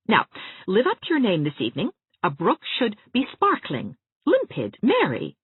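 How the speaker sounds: Anne, reassuring. Acted. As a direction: lift her up gently and brightly. Anne voiced by reader.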